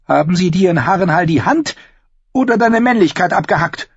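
high, cutting woman's taunt; furious. A narrator's reading. Sister taunts her maimed brother, furious. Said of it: "Dann trat Wut an ihre Stelle".

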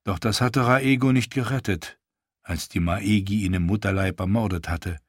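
ordinary delivery

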